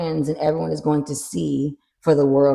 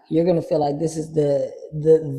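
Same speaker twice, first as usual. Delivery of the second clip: ordinary talk, nothing marked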